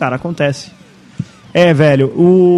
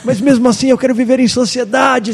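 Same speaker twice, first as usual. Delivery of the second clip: higher, strained plea for help, held back in volume; mock muffled scream; 5 semitones higher than usual. Podcaster voicing his friend's inner cry for help, mock screaming. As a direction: imitate a desperate inner voice crying out. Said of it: "ele tá gritando. Ele fala assim".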